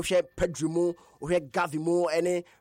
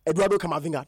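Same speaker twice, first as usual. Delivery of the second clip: ordinary talk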